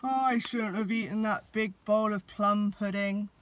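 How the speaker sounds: a stuck Santa grumbling down the chimney; growly Santa. A narrator's reading. Santa grumbling from the chimney, lightly growled. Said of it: "said a growly voice".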